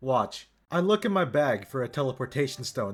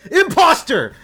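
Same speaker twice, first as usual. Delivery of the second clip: much higher, much louder yell; yelled accusation; 12 dB louder than usual. Acted. Adventurer unmasking the fake oracle, full yell. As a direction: shout it out at the impostor. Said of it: "I yell out to her".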